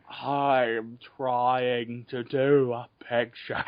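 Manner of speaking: slow, low, ponderous double impression; Nicolas Cage as Alfred Hitchcock. Impersonating an actor playing a director, slow and ponderous. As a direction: draw each word out, low and labored. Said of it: "here's my impersonation of Nicolas Cage doing".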